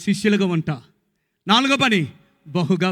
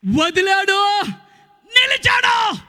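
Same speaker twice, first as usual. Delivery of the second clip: preaching shout; 14 semitones higher, much slower than usual. Real, not acted. Preacher driving home what the son did, shouting. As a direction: shout both words out. Preacher hammering the key point.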